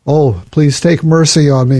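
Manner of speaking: normal talking voice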